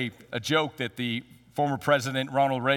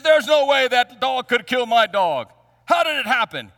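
higher, louder biker outburst; outraged biker; 7 semitones higher, 7 dB louder than usual. Retold or imitated. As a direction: bellow in disbelief. Biker shocked by the Pekingese.

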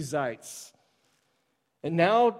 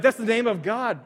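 eager dad; 6 semitones higher, 6 dB louder than usual. Retold or imitated. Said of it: "I was like".

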